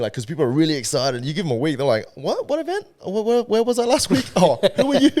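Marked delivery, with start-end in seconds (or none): 2.1-5.1 high, baffled, laughing "what event?"; mock confused. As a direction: play the clueless client who forgot it all. People forgetting him after a week.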